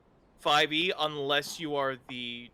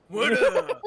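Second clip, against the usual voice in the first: higher, louder character voice restart; character voice, no Texan; 10 semitones higher, 7 dB louder than usual. Acted. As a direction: reset into the intended character voice. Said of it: "I'm going Texan, I'm sorry".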